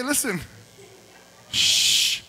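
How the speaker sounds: high scolding mom threat; Mexican mom voice. Retold or imitated. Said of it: "my kids are Mexican".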